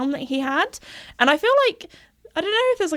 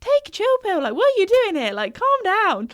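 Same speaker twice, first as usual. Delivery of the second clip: exasperated plea; 10 semitones higher than usual. Retold or imitated. Telling the fidgety horse Joey to calm down, exasperated and high. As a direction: urge him to chill, half laughing. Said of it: "didn't tell him off".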